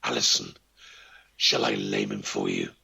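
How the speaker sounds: very low, growling hound voice offering harm; talking demon dog. A narrator's reading. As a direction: drop deep into a demonic dog's voice. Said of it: "the dog talked".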